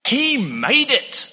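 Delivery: a slow, soaring emphatic declaration of the psalm line; dramatic pronouncement. Shown as a demonstration. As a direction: land "He made it" slowly and grandly, HE high and stretched. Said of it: "and it goes like this".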